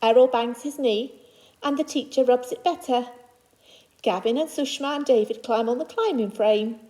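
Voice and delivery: usual speaking voice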